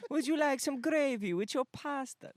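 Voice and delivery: high, loud Italian caricature; squeaky hammed-up Italian accent; Mario impression. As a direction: offer it like a cartoon Italian host. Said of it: "I'm thinking about is Mario".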